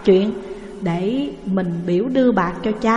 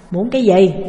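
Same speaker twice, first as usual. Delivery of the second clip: gruff husband; 5 dB louder than usual. Acted. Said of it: "hỏi rằng".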